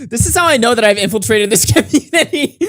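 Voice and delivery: high voice broken by giggles; laughing while talking. Real, not acted. Joking to viewers about the community, giggling throughout.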